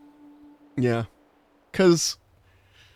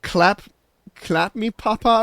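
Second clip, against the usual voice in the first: mock Dutch; 8 semitones higher, slower than usual. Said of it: "someone translated the phrase".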